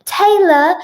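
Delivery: loud, high call of a name; urgent call. Acted. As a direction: call out to your distressed wife. Acted call on finding wife.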